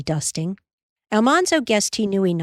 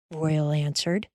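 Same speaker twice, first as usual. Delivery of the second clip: usual speaking voice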